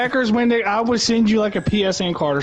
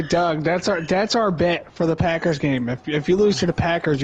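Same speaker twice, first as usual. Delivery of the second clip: ordinary talk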